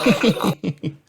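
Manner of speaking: put-on high girls' laugh; two girls giggle; giggling together. A narrator's reading. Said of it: "laughed together".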